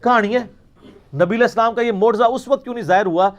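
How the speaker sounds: usual speaking voice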